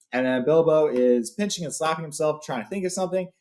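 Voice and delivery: plain reading voice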